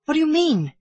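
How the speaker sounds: high-pitched, baffled question; startled cry. A narrator's reading. Kiawe to the drunken bosun, high startled cry. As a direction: cry out in bewilderment. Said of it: "cried Kiawe".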